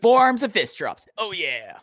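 hammed-up Southern whoop, starting high and loud; Southern good-old-boy cheer, dropping at the end; put-on Southern accent. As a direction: relish it like a Southern wrestling fan. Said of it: "I'm allowed to do the accent".

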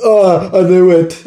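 panicked. Acted. Barry panics.

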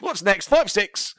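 garage MC voice. Pretend. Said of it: "MC bit continues".

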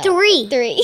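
loud eager answer. Real, not acted. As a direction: blurt it out loudly. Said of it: "You don't have to talk so loud".

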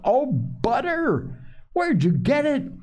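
put-on high, drawn-out astonished boy's cry; delighted surprise. A narrator's reading. Hungry Joel spots the butter, delighted surprise. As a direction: break off into high-pitched amazement.

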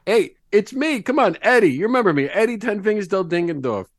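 hungry Eddie pleading at the door. Acted. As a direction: beg to be let in.

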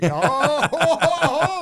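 laughing in disbelief. Real, not acted. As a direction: burst out laughing. Host laughing at racist joke.

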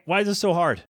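ordinary talk

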